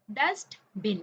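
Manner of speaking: Dustbin broken into dust, pause, बिन; syllable by syllable. Shown as a demonstration. Teacher counting syllables for young pupils, syllable by syllable. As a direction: beat out dustbin slowly as two separate syllables. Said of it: "कितने syllable है".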